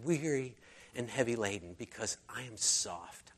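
Jesus inviting the weary, soft and gentle. Retold or imitated. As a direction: speak it like a tender whisper of comfort. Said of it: "where Jesus said".

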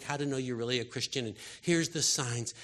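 ordinary talk

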